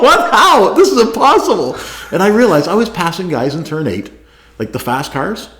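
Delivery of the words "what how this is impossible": high, laughing, mock-stunned protest; laughing disbelief. Driver told he leads the race, laughing in disbelief. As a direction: act floored, giggling through it. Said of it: "I go".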